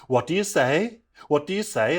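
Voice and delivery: lightly imitated teacher demanding an answer; prompting teacher. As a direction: press a child for a reply. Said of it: "the teacher looks at us and goes".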